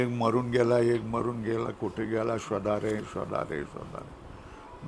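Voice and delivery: fading lament to a breathy whisper; mock weeping. Boys in the story lamenting their lost friend, fading mock weeping. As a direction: grieve softly until the voice dies. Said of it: "मग रडायला लागले".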